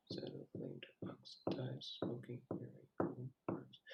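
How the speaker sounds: near-whispered poem reading, very soft; reading under breath. Real, not acted. Reader checking where stresses fall, reading under breath. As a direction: test the verse's rhythm quietly to yourself.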